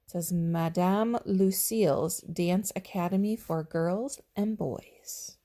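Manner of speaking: usual speaking voice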